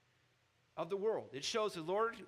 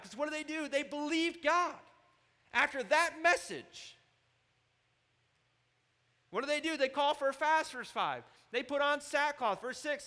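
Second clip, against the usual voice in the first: much higher and louder, driving sermon climax; full-force preaching; 10 semitones higher, 9 dB louder than usual. Acted. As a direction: preach it hard to the back row.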